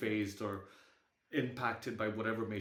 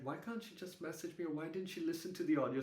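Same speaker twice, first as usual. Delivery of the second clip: soft, higher-pitched imitated grumble; inner complaint; 6 semitones higher, 3 dB quieter, faster than usual. Part of the head questioning aunt's call, soft inner complaint. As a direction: voice a small complaining thought under your breath. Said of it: "part of my head that's like".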